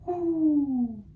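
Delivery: high, sung owl hoo; Mama Owl hoot. Shown as a demonstration. Teacher modelling Mama Owl's singing voice, high. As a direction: float a high pretty hoo. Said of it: "Mama Owl has a beautiful singing voice".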